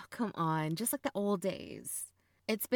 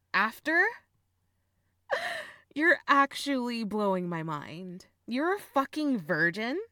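loud, higher, incredulous outburst; astonished, with a burst of laughter; amused shock; 4 semitones higher, 7 dB louder than usual. Acted. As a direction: blurt it out, mind blown. Incredulous at brother's confession.

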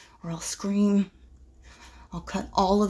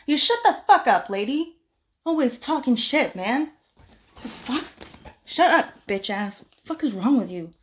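angry shout; 4 semitones higher, 8 dB louder than usual. Acted. Yelling at classmate.

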